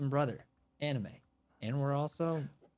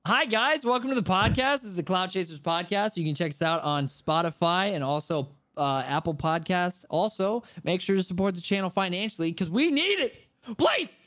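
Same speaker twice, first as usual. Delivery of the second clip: high, loud host greeting building to a squealed plea; podcast intro; 7 semitones higher, 7 dB louder than usual. Acted. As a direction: pitch the show loudly, then beg high.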